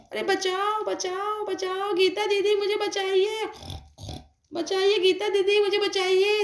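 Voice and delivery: high, pleading cries to Geeta didi; mother begging Geeta didi for rescue, high and desperate; desperate call for help. Acted. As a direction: beg the neighbour to come save you. Mother calls neighbour for help.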